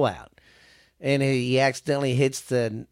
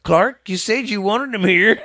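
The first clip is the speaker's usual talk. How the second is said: higher, louder proud cousin voice; dumb cousin; 7 semitones higher, 6 dB louder than usual. Retold or imitated. Retelling the cousin's line to Clark, higher and louder. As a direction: say it like the dimwitted cousin pleased with himself. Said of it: "what does his dumbass cousin do".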